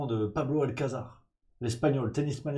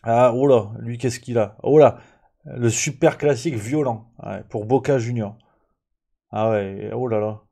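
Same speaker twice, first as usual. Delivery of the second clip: much louder startled exclamations; a louder burst of astonishment at a violent match picture; excited; 10 dB louder than usual. Real, not acted. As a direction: react loudly to a shocking photo. Reacts to violent football photo.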